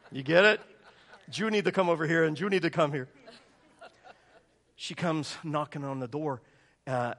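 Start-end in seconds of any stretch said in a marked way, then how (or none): 1.3-3.1 loud, lightly imitated Puerto Rican accent. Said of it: "She's from Puerto Rico".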